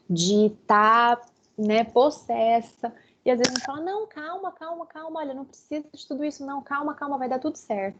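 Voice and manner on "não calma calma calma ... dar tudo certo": soft, higher calm-down shushing; mock soothing. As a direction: hush them down, patronizingly gentle. Mocking someone hushing an angry person. (imitando alguém que acalma, baixinho e mais agudo)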